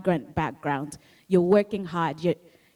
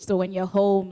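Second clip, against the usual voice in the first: usual speaking voice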